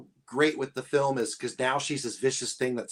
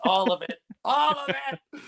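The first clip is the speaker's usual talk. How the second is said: mock panic; 11 semitones higher than usual. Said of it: "he goes".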